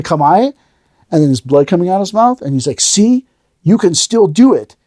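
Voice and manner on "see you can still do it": higher, slow, emphatic proof to a pupil; acted triumphant encouragement. Bloodied teacher to one-armed student, acted emphatic encouragement. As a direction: play the master driving the point home.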